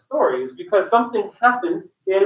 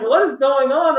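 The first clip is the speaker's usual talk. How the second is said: higher, puzzled and laughing; laughing bafflement; 6 semitones higher than usual. Retold or imitated. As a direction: act baffled, laughing through it. Acts out baffled reaction, laughing.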